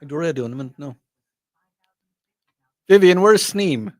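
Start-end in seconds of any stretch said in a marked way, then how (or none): 2.9-3.9 calling out. Real, not acted. Calling across studio to guest.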